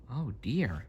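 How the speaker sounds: soft dismay. Acted. Narrator gasping softly at the stolen harp. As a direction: murmur the shock quietly. Narrator aside reacting to theft.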